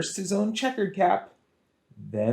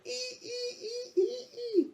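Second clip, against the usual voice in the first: very high, thin monkey squeal; monkey squeaks; 9 dB quieter than usual. A narrator's reading. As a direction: squeak like a teasing little monkey.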